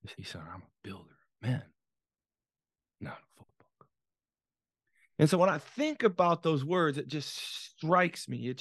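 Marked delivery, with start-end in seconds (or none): 0.0-1.6 low, very soft near-whisper; hushed father. Retold or imitated. Father confiding his true work to son, solemn whisper. 3.0-3.9 very soft, solemn whisper. Retold or imitated. Father finishing his confession to son, whispered.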